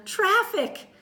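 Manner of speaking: high, loud panicky yell; alarmed cry. Acted. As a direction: shriek at the cars. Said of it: "cried Josefina".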